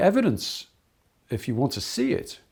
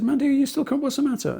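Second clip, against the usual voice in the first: coaxing concern; 6 dB louder, faster than usual. Retold or imitated. Teacher coaxes crying pupil.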